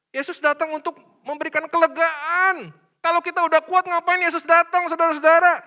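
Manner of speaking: rising to a loud, high preaching call; preaching at full force. Acted. Pastor urges the congregation, building to a loud call. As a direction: lift the whole room with it.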